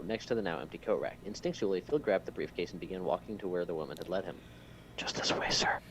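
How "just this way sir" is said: whispered assistant's line. Acted. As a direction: say it in a polite whisper. Assistant's line whispered in story reading.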